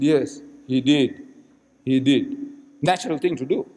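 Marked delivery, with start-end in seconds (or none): 0.0-2.4 slow, lower reluctant reply; slow admission. Retold or imitated. Cornered Maulana answering the Reverend, slow admission. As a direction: concede it slowly, word by word. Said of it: "So the Maulana started slowly".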